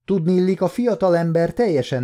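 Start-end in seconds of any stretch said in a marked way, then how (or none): none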